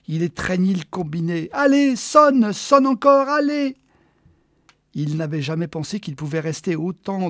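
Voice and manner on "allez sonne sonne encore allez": high, loud desperate urging; pleading shout. Acted. Man clutching the receiver, shouting at it to ring. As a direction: beg the phone out loud.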